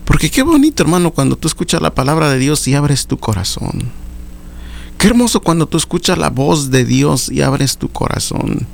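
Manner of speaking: high, impassioned sermon delivery; fervent preaching. Acted. Preacher urging brothers to answer God's call, impassioned high preaching. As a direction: lift the congregation, preach with fervour. Preacher exhorting at full force.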